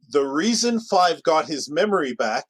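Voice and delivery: normal talking voice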